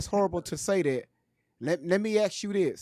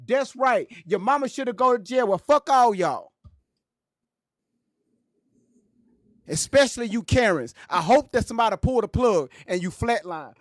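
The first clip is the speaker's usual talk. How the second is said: raised, high and heated, tearing into them; angry rant; 6 semitones higher, 6 dB louder than usual. Real, not acted. Speaker furious at Tory's critics, shouting them down. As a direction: lay into the Karens with real heat.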